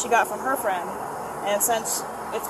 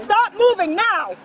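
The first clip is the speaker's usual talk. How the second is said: angry command; 7 semitones higher, 5 dB louder, much slower than usual. Real, not acted. Officer struggling with suspect.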